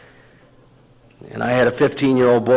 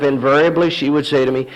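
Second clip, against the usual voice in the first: ordinary talk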